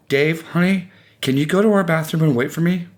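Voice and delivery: high, careful coaxing voice; anxiously sweet request; coaxing wife. A narrator's reading. Wife coaxes confused husband.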